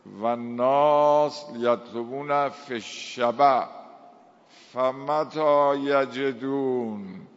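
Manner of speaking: slow, emphatic Arabic recitation; sacred recitation. Acted. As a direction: draw out the long vowels, ringing out each phrase end.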